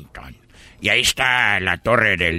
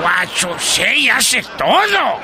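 high, loud yell of disbelief; amazed shout; 3 dB louder, much slower than usual. Acted. Tourist shouting at the gringo's answer in disbelief. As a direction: yell out in comic shock.

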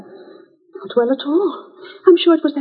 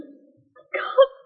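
choked, tearful voice; crying; 4 semitones higher, slower than usual. Acted. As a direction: sob the words out. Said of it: "without blubbering".

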